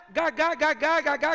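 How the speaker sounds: stuttering Moses, mock stammer on gagá. Moses telling God he stutters, mock stammer.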